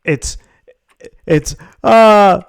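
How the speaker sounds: ordinary talk, nothing marked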